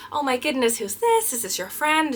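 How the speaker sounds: put-on high, sweet princess voice for a child; greeting a young fan's bunny in princess character. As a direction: gush like a storybook princess to a little girl. Voicing her Elsa princess persona.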